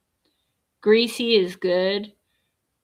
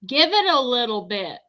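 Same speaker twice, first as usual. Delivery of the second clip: exasperated rant; 7 semitones higher, 5 dB louder than usual. Retold or imitated. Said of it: "And so I'm like".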